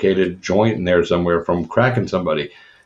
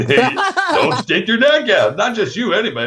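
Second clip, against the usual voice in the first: high, laughing and slow; high laughing warning, slow; 11 semitones higher than usual. Real, not acted. Laughing advice.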